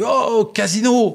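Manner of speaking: high, slow, loud call across a field; man at the far end of the stadium bellows the wrong name. Retold or imitated. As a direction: yell it to someone far off, stretched out. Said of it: "le gars qui était au bout du stade, il fait".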